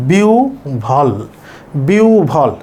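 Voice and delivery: বিউ ভল split as "biu... bhol"; correct pronunciation. Shown as a demonstration. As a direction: pause between বিউ and breathy ভল. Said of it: "তাহলে এটার উচ্চারণ হচ্ছে".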